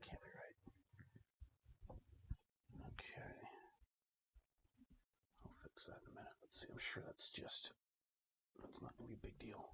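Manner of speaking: mumbled whisper to himself. Real, not acted. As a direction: whisper it under your breath. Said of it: "Sorry for the whispering, mumbling".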